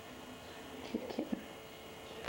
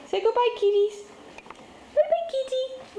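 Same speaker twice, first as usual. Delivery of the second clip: pet talk goodbye; 6 dB louder than usual. Real, not acted. Saying goodbye to the kittens, high sing-song. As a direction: wave them off up high.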